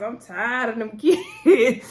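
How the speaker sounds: slow, whiny complaint broken by a laugh. Retold or imitated. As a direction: groan it out while laughing. Chris complaining about the kids.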